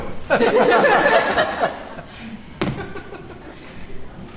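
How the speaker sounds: high, airless giggle; high breathless laugh. Real, not acted. Laughing at her own joke about Bingley, high and airless. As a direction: laugh helplessly at the dog joke.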